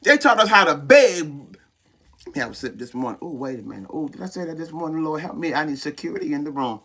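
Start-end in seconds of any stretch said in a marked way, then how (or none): 2.3-6.8 low, soft mock-worried aside; under-the-breath comic retreat; hushed aside. Real, not acted. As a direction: drop the voice as if you said too much. Aside after a bold admission.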